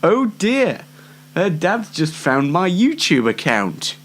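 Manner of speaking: normal talking voice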